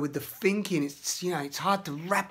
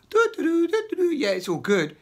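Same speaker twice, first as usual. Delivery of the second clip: much higher, louder breezy singsong; fake-happy sing-along dismissal; mock carefree; 11 semitones higher, 3 dB louder than usual. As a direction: brush it all off like nothing matters. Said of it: "cover that shit up with some".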